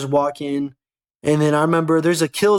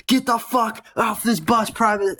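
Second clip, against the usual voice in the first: mock drill sergeant barking, pitched higher and slower; a higher, slower imitation of a drill sergeant ordering a private off the bus; 7 semitones higher, slower than usual.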